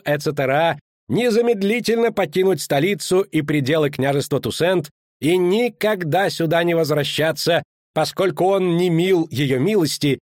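high, sustained herald's reading; official proclamation. Acted. Official reading the duchess's pardon to townspeople, proclaiming. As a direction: declaim it formally to the public.

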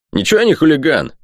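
high-pitched indignant protest. A narrator's reading. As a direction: burst out in offence, pitched well up. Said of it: "вдруг возмутился Сэмми". (возмущённо, высоким голосом)

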